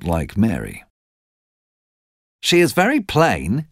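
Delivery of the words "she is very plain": Medlock's remark. A narrator's reading. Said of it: "said Missus Medlock".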